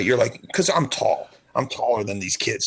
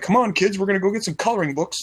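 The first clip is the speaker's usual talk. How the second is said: high, chirpy mock parent; lightly voiced cheery dad; 8 semitones higher than usual. Parent rounding up kids at a diner, chirpy. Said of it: "some normal place that you're like".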